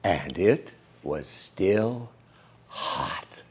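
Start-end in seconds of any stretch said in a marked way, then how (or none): none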